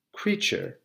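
ordinary delivery, nothing marked

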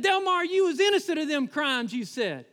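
high, put-on voice of a movie sidekick; mock challenge. Friends challenging Delmar on his claimed innocence, high mock protest.

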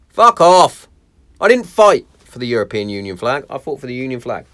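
loud angry retort; swearing tirade. Real, not acted. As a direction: bark back in outrage. Angry retort after mock voice.

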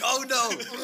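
high, loud mock alarm; badly faked panicked yell; yelling back. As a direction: shout a hammed-up fake reaction. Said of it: "you're yelling back to me".

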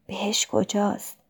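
a soft, private murmur; lightly hushed, questioning oneself; under the breath. A narrator's reading. Jane asks herself about heaven, under her breath. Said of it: "زیر لب از خودم پرسیدم".

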